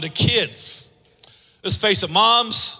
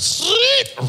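much higher mock machine word; GPS chime. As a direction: imitate the phone's little chime word. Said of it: "all of a sudden it goes".

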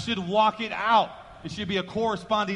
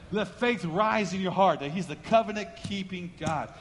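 ordinary delivery

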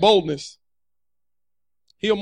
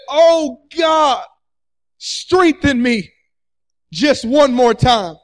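fervent prayer; 2 semitones higher, 4 dB louder, much slower than usual. Retold or imitated. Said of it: "prayed in his time of distress".

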